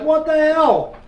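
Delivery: retold bewildered outburst; stunned disbelief. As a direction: throw it out in shocked disbelief. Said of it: "I'm like".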